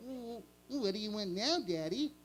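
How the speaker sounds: whiny sidekick bear; Boo Boo voice. Retold or imitated. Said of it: "in cartoon voices".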